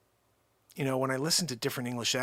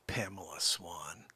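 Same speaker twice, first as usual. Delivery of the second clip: breathy whispered name, barely voiced; a hushed, secret call-out to a viewer; 10 semitones lower, 11 dB quieter, slower than usual. Real, not acted.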